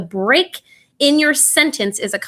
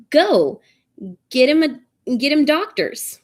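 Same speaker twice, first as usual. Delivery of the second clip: "Go", break, then "get him doctors" pitched up; urgent command with pause; 5 semitones higher, slower than usual. Shown as a demonstration. Teacher acting an order for a hurt kid, pause after "Go". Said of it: "he's being shouted at to go".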